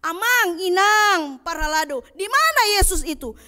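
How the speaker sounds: put-on high, pleading child voice asking parents; child's question. A child asks parents where Jesus is, high and pleading. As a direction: play a little child calling out to Mama and Papa.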